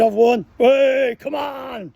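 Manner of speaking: high, loud triumphant yell of "I've won"; retold winning shout. Winner shouting across the mess at the raffle, loud and high. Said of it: "Screaming and shouting".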